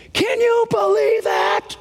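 high, drawn-out mock outrage; scandalized disbelief. Pharisees accusing Jesus of receiving sinners, scandalized. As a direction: cry it out, aghast and whiny.

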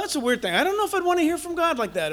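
usual speaking voice

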